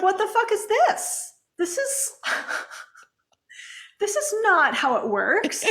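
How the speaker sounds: high-pitched exasperation breaking into laughter; hammed-up indignant complaint; mock frustration. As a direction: protest high, then laugh at your own outrage. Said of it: "I've experienced a lot of frustration".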